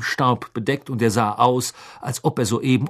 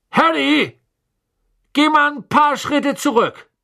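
fatherly voice; 6 semitones higher than usual. A narrator's reading. Mr Weasley calming boys.

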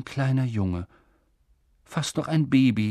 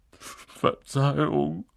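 slow, tearful sorry from the giant; sobbing apology; 4 semitones higher, much slower than usual. A narrator's reading. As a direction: choke the word out through tears. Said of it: "schluchzte Hagrid".

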